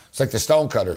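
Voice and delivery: ordinary delivery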